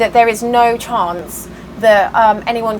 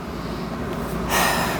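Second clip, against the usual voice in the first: long, soft, tired exhale; a real sigh of fatigue; weary sigh; 10 dB quieter than usual. Real, not acted. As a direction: let out a heavy breath of exhaustion. Venting stress over the land deal.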